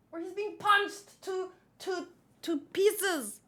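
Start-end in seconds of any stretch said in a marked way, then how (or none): none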